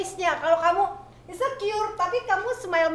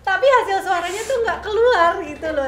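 laughing; 5 semitones higher, 7 dB louder than usual. Real, not acted. Laughing while explaining.

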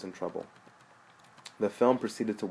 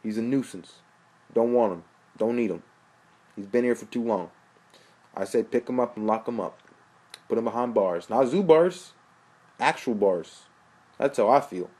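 agitated rant; 2 semitones higher, 6 dB louder than usual. Acted. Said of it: "Kyle looking agitated".

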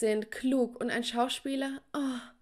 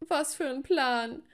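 sarcastic; 4 semitones higher, slower than usual. Acted. Learns she's the silent maid.

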